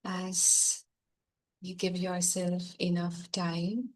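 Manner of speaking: soft, slow meditation voice over music; guided meditation. Acted. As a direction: gently ease them back to the room.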